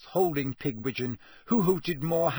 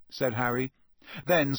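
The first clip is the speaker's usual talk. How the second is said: ordinary delivery, nothing marked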